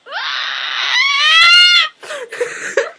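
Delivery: shrieking with delight, high and loud; high squealing shriek. Real, not acted. Fan squealing after her idol thanked her for the letter, loud and high. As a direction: let out a thrilled squeal.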